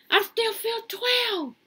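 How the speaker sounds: put-on sing-song it up high like a kid; high, slow childlike refrain. Refrain about feeling twelve.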